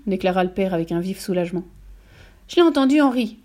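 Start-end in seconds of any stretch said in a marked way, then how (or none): 2.5-3.4 mildly quavering, high, loud yelp; raised, slightly quavering cry. A narrator's reading. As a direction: yelp it in understated fright. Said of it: "glapit la mère d'une voix toujours aussi chevrotante".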